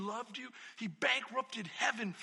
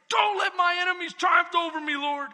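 loud, higher cry to God; bold plea; 6 semitones higher, 9 dB louder than usual. Retold or imitated. Preacher voicing David's bold plea, loud. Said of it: "and say".

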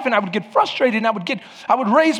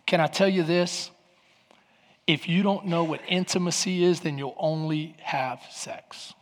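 low, soft, intimate preaching; hushed pastor leaning into the mic; hushed sermon; 5 semitones lower, 7 dB quieter than usual. Acted. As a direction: draw them in quietly.

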